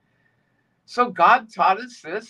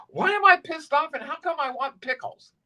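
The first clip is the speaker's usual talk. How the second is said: puzzled; 8 semitones higher than usual. Retold or imitated. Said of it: "you go".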